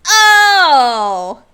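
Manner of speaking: sudden, loud, high "Oh!"; surprised exclamation. Real, not acted. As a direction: caught off guard by good news. Surprise at a subscription alert.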